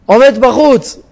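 usual speaking voice

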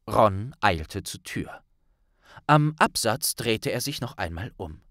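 ordinary delivery, nothing marked

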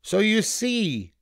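slow, high, smug rabbit; high-pitched self-satisfied rabbit; bragging rabbit. A narrator's reading. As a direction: draw it out high and boastfully. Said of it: "bragged the rabbit".

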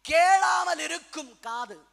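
slow, loud pulpit call; proclaimed heading. Real, not acted. Preacher naming ears that will not hear, slow shout. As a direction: draw out the words forcefully.

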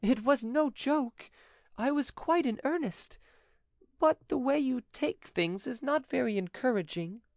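high, hesitant woman's voice; timid widow. A narrator's reading. Widow confesses she was in earnest, high and timid. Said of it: "in a timid voice".

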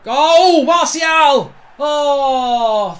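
loud, high cry of the scorer's name; goal celebration shout. Real, not acted. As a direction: yell out the goal in relief.

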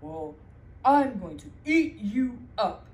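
loud menacing troll. Acted. Troll threatening the little goat, loud and menacing. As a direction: growl it out as a hungry troll.

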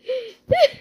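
high mocking cackle; gloating laugh. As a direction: laugh in triumph. The dog gloats over the chips.

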